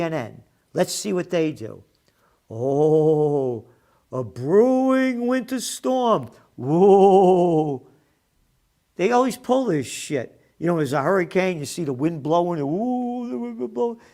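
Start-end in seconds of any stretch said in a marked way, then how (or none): 2.5-7.9 mocking. Mocking TV weather hype. 12.6-14.0 high mock "ooh, the wind blowing"; mock the breathless storm report; mocking news hype.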